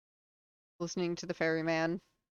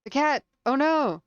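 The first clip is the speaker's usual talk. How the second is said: gasp, then high loud exclamation; startled dismay; 7 semitones higher, 8 dB louder than usual. Real, not acted. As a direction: cry out in alarm, high and loud. Startled by cat in game.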